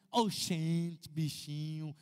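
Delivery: a light Northeastern Brazilian lilt on "Oxente, bichinho"; Northeastern Brazilian accent. Retold or imitated. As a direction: mildly imitate the sing-song Northeastern Brazilian accent. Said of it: "as pessoas falavam meio cantando".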